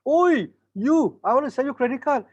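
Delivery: loud high call of a pushy seller; mall card seller. Retold or imitated. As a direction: grab a passerby, calling out. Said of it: "they rush to you".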